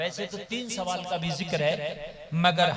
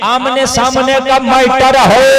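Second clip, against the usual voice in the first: louder, slow, hammering phrase; emphatic preaching; 5 semitones higher, 17 dB louder than usual. Acted. Preacher stressing face to face to the crowd, emphatic. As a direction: drive the point home slowly.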